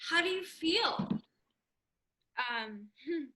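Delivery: barely above a murmur, stunned; shaken and quiet. Acted. Janie asking herself how she feels after hanging up, hushed.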